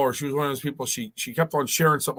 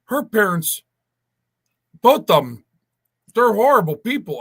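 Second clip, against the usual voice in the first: emphatic retold rant; 8 semitones higher, 5 dB louder than usual. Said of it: "I go".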